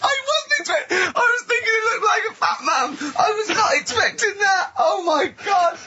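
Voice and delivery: squeaking high through tears of laughter; crying with laughter, voice cracking up high; helpless laughing. Real, not acted. Seeing the picture of the mum, squealing with laughter. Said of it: "It's a silent laugh".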